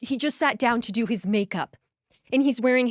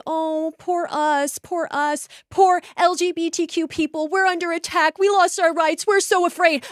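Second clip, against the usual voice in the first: mocking whiny voice; 6 semitones higher than usual. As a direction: mimic them as self-pitying victims. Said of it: "So then they could say".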